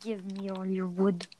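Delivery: quiet retake. Acted. Told not to scream, repeating the demand low and soft. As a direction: drop it right down after being told not to scream. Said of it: "no need to scream".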